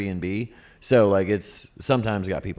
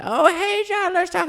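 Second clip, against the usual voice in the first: put-on nosy old lady voice; 4 dB louder, slower than usual. Mocking nosy neighbor biddies.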